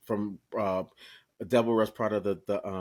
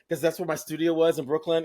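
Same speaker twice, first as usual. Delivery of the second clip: ordinary talk, nothing marked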